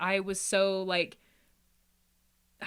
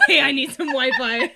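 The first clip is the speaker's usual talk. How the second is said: high, loud jokey man voice through laughter; laughing as a pretend visitor asking for Wi-Fi; mock guy at the door; 6 semitones higher, 9 dB louder than usual. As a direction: play the guy knocking, laughing.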